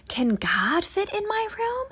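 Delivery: put-on high wondering child voice; amazed little boy. Little boy amazed, asking his mother, high voice. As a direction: ask it wide-eyed, high.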